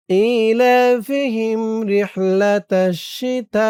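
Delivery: slow, melodic tajweed chant; Quran recitation. Shown as a demonstration. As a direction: recite slowly like a qari. Recites the verse. (ধীর সুরেলা তিলাওয়াত)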